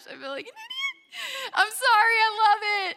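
high, tearful, breaking voice, a sob on sorry; crying apology to husband; retold crying apology. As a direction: blurt the apology while crying. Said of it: "I'm crying at this point".

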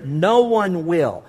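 No one will, said very slowly with weight; weighty slow reading of will; slow stress. Shown as a demonstration. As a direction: draw out No one will deliberately. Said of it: "circle the word "will".

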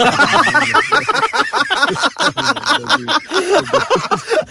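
long, high-pitched burst of real laughter; helpless laughter. Real, not acted. Podcast host cracking up at a co-host's joke, high helpless laughter.

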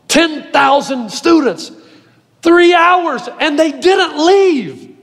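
high-pitched, emphatic pulpit delivery with weighty pauses; impassioned preaching. Real, not acted. Pastor tells congregation why students stayed, impassioned preaching. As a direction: preach it hard, punching key words.

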